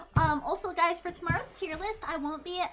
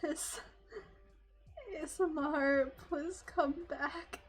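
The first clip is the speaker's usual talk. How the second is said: soft, slow, pleading mock whisper; mock plea; 2 semitones higher, 8 dB quieter, slower than usual. Streamer softly begging chat to come back, slow and hushed.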